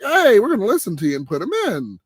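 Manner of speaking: high, cocky voice of the coaches; mock team announcement. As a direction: ham up the smug coaching staff. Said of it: "and they finally like".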